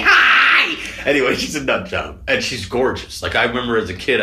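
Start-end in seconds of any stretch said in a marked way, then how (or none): 0.0-0.8 high, loud, crazy mock greeting; Fran Drescher. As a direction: belt out a hammed-up piercing hello. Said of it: "She's like a crazy".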